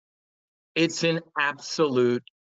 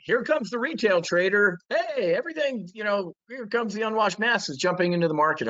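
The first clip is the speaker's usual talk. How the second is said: higher, sing-song mocking of a news headline; 7 semitones higher than usual. Mocking the headline about retail traders, in a higher sing-song voice. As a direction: ham up a breathless headline writer. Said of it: "the converse headline".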